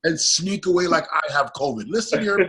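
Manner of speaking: high-pitched, comic exasperation; mock indignant rant. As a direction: rant in mock outrage for laughs. Mock rant about food drop-offs.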